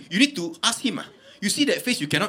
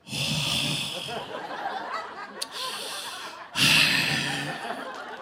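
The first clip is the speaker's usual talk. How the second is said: long, heavy mock sigh; hammed-up weary exhale; overacted sigh; 6 dB quieter than usual. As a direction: let out a huge, drawn-out breath like a ham actor. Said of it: "this doctor starts overacting from the time he comes out".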